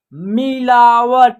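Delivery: very slow, each syllable apart; slow clear word demo. Shown as a demonstration. Teacher modelling a new word for children, very slow. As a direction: drag the word out for beginners. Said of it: "म में छोटी की मात्रा मी".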